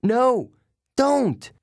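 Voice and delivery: high, loud scream of warning. A narrator's reading. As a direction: cry out in alarm. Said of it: "Annabeth screamed".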